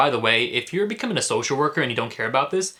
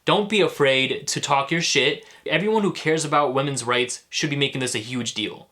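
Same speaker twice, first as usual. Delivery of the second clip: ordinary talk, nothing marked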